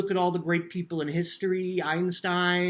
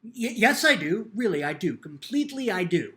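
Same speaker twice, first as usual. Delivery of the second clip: louder, higher, stammering protest; flustered denial; 5 semitones higher, 7 dB louder than usual. Acted. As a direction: bluff your way through.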